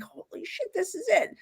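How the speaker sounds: whispered disbelief that drops back into voice; hushed shock at finding the very spot; breathless whisper at the stone wall; stunned whisper. Retold or imitated. Finding the wall from her vision.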